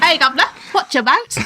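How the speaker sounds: high mock Cockney; playful, high-pitched stage Cockney. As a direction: ham up a chirpy fake English accent.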